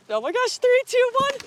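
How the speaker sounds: nervous excited countdown, rising high; sledding downhill. Real, not acted. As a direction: count down, thrilled and a bit scared.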